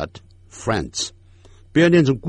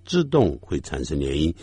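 ordinary talk, nothing marked

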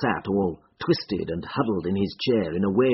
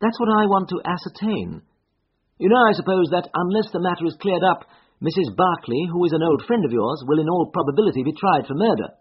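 lightly voiced higher-pitched investigator; Holmes, questioning; 8 semitones higher, 3 dB louder, faster than usual. Investigator warns of a murder trial, lightly voiced and earnest. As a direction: set out the matter in a raised voice.

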